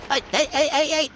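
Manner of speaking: squeaky alarmed yelps, way up high; high startled yelps. Real, not acted. Gamer yelping at a sudden creature on screen, high squeaks.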